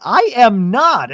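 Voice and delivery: slow, high, loud retort; drawn-out, emphatic pushback; mock indignant denial. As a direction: imitate an offended friend digging in. Acted back-and-forth denial.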